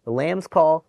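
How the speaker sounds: bleating lamb call. Acted. Lambs calling to mothers.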